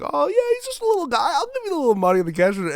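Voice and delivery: very high, loud, gushing voice, rushing at the end; cooing cashier. Retold or imitated. Cashier handing over the money, gushing. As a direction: gush over him like he's adorable. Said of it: "the guy at the cash register is like".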